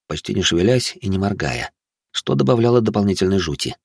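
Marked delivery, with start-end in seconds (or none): none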